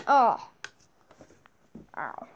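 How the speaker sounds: usual speaking voice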